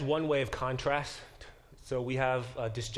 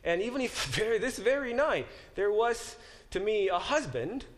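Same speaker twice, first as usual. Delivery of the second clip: pleading Naomi; 9 semitones higher than usual. Acted. Teacher reading Naomi's plea aloud.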